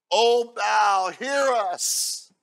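loud, high desperate cry to Baal; calling out. Acted. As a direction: cry out to a god who isn't listening.

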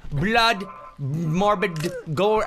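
ordinary talk, nothing marked

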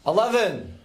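exasperated retry. Acted. Man retrying a voice-controlled lift, exasperated and high.

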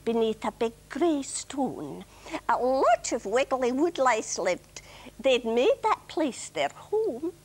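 put-on a light Scottish accent for the rhyme; gently Scottish children's verse. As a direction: recite it the Scottish way she first wrote it. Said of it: "I have to say this in a Scottish accent".

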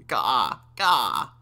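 put-on happy raven-baby caw; short rasping squawks with a swooping fall; rasping raven crow. A narrator's reading. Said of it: "crowed the happy baby in Raven's rasping voice".

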